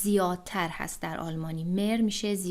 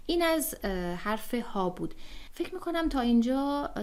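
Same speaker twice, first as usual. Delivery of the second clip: normal talking voice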